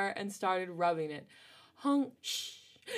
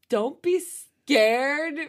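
slow; 6 semitones higher, 11 dB louder, much slower than usual. Acted. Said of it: "I can't say this seriously".

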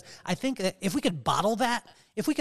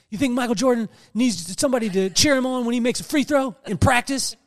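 tough brother; 7 semitones higher, 7 dB louder than usual. Retold or imitated. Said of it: "And he's like".

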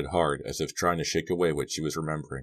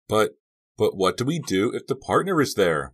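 stammered, higher and louder question; worried; 6 semitones higher, 6 dB louder than usual. A narrator's reading. Girl afraid the partner is there, anxious. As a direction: ask it anxiously, pitched up. Maya upset, fears the partner.

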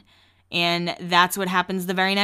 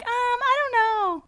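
hesitant doubt. Retold or imitated. Friends hesitant about Heidi moving.

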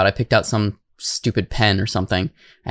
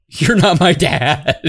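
retold scream; 4 semitones higher, 4 dB louder, slower than usual.

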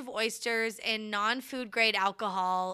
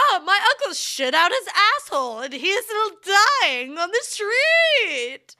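frantic melodramatic plea; 11 semitones higher, 8 dB louder than usual. Acted. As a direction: wail for help in a panic. Acting out a frantic call.

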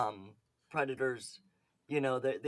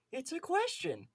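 much higher, held-up imitation voice; catchphrase impression; 11 semitones higher than usual. Podcaster quotes the predator's famous catchphrase, high-pitched impression. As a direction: put on his squeaky-high catchphrase voice. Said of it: "lovely catchphrase of".

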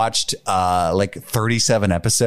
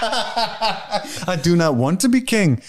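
slow, laughing Schwarzenegger-style accent; every word weighed out like Arnold; 9 semitones higher, much slower than usual. Shown as a demonstration. To the laughing co-hosts, a line as Uhtred in a Schwarzenegger accent. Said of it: "sounded like Pauly Shore is fucking Arnold Schwarzenegger".

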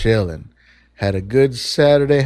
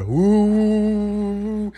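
long "ooh" rising to a much higher drone; louder fan whir imitated; 10 semitones higher than usual. Opening the door on the fans, higher imitated whir. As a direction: imitate fans roaring up in pitch. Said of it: "It gets louder".

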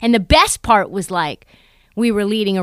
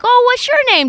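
put-on high, cooing question to a kid; high kid-directed greeting; talking to a child; 14 semitones higher, 5 dB louder than usual. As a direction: ask a little kid, pitched way up. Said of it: "I'm like".

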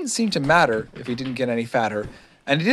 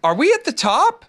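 high, eager question; excited little Roo; 14 semitones higher, 4 dB louder than usual. A narrator's reading. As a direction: ask it high, bursting with eagerness. Excited Roo keeps asking.

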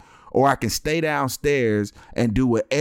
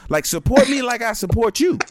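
higher, louder, pleading; mock indignant father, pitched up; indignant dad; 11 semitones higher, 4 dB louder than usual. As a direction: plead like a put-upon father. Dad's mock rant to daughter.

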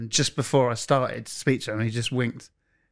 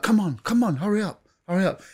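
higher, urgent calls of come on; impatient hurrying; 7 semitones higher than usual. Retold or imitated. Manager hurrying the cameramen, impatient higher calls. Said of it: "He was like".

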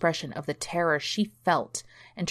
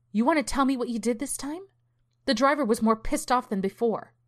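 put-on higher, fast scolding of a rude teen; angry mother; 7 semitones higher than usual. A narrator's reading. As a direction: tear into him, quick and sharp. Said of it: "Nancy glared at her rude teen".

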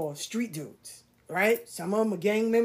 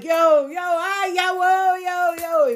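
higher, louder friendly calls; cheerful greeting; 8 semitones higher, 5 dB louder, slower than usual. Retold or imitated. Street friends greeting her after closing, bright calls. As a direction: hail her warmly across the street. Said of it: "made me feel so, like".

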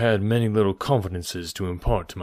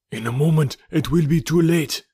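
higher and louder, hurried alarm; urgent cry; 7 semitones higher, 5 dB louder than usual. A narrator's reading. Zodar urges them to flee the fire, loud high alarm. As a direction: shout them out of the smoke. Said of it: "cried Zodar".

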